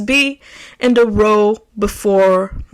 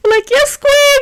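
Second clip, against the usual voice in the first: high, louder yes queen cheer; mock hype; 4 dB louder than usual. Hyping herself up, a high mock cheer. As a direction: cheer yourself on like a fan.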